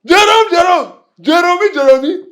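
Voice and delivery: high, loud calls of the name; loud high-pitched name-calling; taunting shout. Acted. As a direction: holler the name in mocking disbelief. Worker taunts the new boss.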